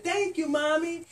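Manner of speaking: put-on high little baby thank-you. Baby bunny thanking its mommy in a high voice. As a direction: squeak it like a tiny baby.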